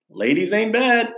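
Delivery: high, sly and smug; a teen boy's wink; lightly played smirking teenager; cheeky remark. His cheeky reply to friends.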